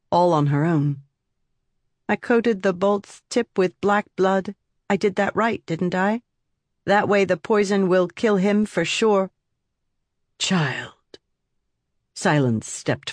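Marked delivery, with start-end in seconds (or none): 2.1-9.3 put-on higher eerie little girl; lightly voiced chilling child. Child figure voice. 10.4-11.2 breathless shocked whisper; barely voiced warning.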